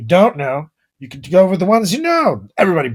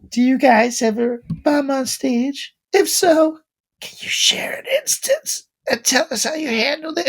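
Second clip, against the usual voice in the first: [name] voice; 10 semitones higher than usual. Retold or imitated. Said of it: "he sounds like [name] to me".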